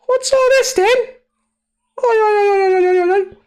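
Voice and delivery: high, loud mock British oi chant; British bloke. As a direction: yell oi oi like a British lad.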